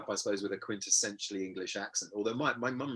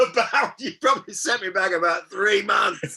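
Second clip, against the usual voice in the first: laughing; 10 semitones higher, 12 dB louder than usual. Retold or imitated. Recalling his thought, laughing.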